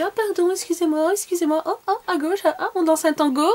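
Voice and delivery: high, flustered mock apology. Blocking a friend at the stand, flustered high apology. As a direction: replay the awkward bump, squeaky and polite. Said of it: "On s'est dit".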